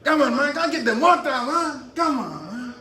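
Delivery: put-on Spanish accent. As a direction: shout orders in an overdone Spanish accent. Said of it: "Spanish accent".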